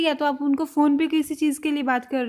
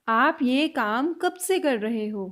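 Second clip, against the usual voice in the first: very slow repeat; 2 semitones lower, much slower than usual. Shown as a demonstration. Teacher slowing the question down for learners. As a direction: stretch it out word by word. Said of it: "next sentence".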